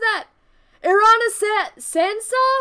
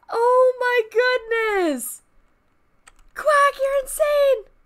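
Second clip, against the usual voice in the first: higher, stretched-out disbelieving praise; amazed; 3 semitones higher, much slower than usual. Real, not acted. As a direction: marvel at the gift, slowly. Big gift reaction.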